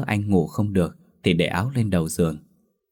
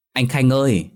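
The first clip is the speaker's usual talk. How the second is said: usual speaking voice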